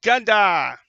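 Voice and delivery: loud call for the next player. Real, not acted. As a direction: call his name across the table. DM calls on player's turn.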